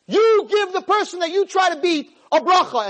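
high, forceful divine order; Hashem commanding. Acted. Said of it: "Hashem says to him".

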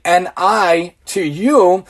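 "N I to you" drawn out letter by letter; sounding out slowly. Shown as a demonstration. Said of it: "If you say it slowly".

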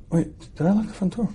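acted nagging doubt, barely voiced; self-doubt. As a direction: catch yourself and wonder under your breath. Man doubts himself again.

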